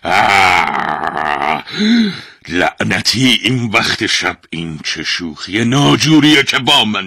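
loud angry yell at being woken; angry demon shout. A narrator's reading. Demon woken again, shouting at the golden object. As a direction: roar it out, furious at the trick. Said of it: "با عصبانیت فریاد زد".

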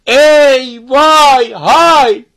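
long drawn-out, high, full-voiced call; loud calling shout. A narrator's reading. Father summoning the master at the spring, shouting. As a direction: holler each word slowly into the distance. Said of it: "با صدای بلند فریاد زد".

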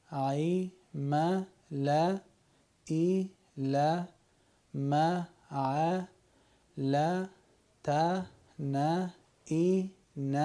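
Quran lines read one syllable at a time. Shown as a demonstration. Teacher reading Quran lines letter by letter. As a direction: sound out each letter with its vowel.

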